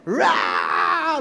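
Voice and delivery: loud mock child's wail; imitated loud bawling. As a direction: burst out bawling like a small child. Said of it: "makes me cry even louder".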